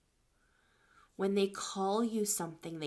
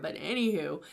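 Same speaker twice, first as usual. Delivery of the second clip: ordinary delivery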